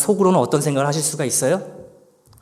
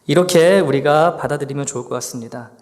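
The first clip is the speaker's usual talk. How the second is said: normal talking voice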